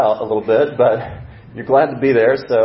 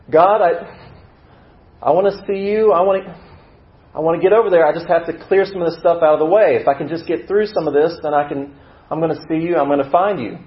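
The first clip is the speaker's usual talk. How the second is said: high, raised-voice plea; sincere longing; 7 semitones higher, 4 dB louder than usual. Acted. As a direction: plead upward to someone out of reach. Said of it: "your heart is sincere in this. You really want it".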